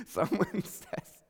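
real laugh breaking into the words, drawn out. Real, not acted. Pastor laughing at his own word crap, real laugh. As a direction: crack up mid-sentence. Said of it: "I'm laughing because".

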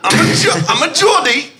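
put-on high, emphatic Geordie accent. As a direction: play it as an excitable Geordie accent. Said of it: "I don't normally sound like one".

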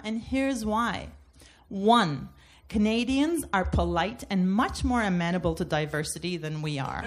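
ordinary delivery

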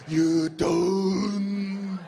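put-on breathless, slowed Korean accent, gasping between words; out of breath. Comedian mocking Korean English, breathless and slow. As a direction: pant the words like after a marathon. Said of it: "they sound like they're out of breath".

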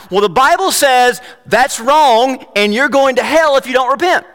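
high, loud condemning retort; loud, preachy scolding, pitched high; self-righteous. Retold or imitated. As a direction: re-enact a righteous telling-off. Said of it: "And I was so right".

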